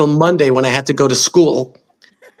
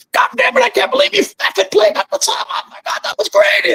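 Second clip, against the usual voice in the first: much higher, shouted, thrilled promoter; ecstatic shout. Retold or imitated. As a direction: yell it out in disbelief and joy, voice cracking high. Promoter lifts him up after show.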